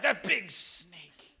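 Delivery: put-on slow, drawn-out kid voice; imitating his son. Son showing how big the snake was, slow awed kid voice. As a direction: stretch it out like an awed little boy. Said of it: "He's like".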